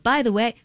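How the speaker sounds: BY THE WAY pitched high and emphatic; belabored take. Shown as a demonstration. Host trying a line for the episode, belabored take. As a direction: punch out "By the way" brightly as a studio take. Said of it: "we belabor each take".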